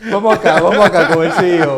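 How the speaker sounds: loud, laughing through the words. Real, not acted. Host laughs while moving on to the CEO, laughing loudly.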